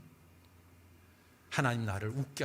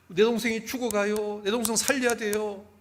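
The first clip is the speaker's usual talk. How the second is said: much higher, loud pleading wail; wailing child; 9 semitones higher, 7 dB louder than usual. Retold or imitated. Girl begging the pharmacist to save her sister, wailing. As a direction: beg through the tears like a desperate girl. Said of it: "막 애가 막 울부짖으면서 얘기하는 거예요".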